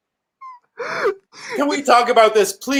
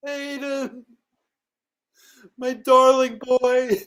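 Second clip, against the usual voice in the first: ordinary talk, nothing marked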